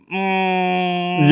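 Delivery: a naughty, superior little hum; condescending mm. Shown as a demonstration. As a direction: friend demonstrating sass with a hum. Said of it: "a little condescending".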